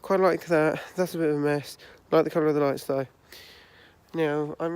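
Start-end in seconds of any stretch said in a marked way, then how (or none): none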